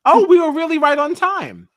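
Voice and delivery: sudden startled realization; realizing the timing was different; surprised realization. Real, not acted. Surprised recollection.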